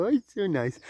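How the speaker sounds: fake pleasantness. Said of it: "pretend that you do".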